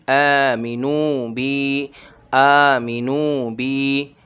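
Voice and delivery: very slow अ मी नू बी, मी short, नू and बी held long; slow chanted recitation; stretched नू and बी. Shown as a demonstration. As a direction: clip मी, stretch नू and बी. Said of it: "मीम नहीं खींचेगा".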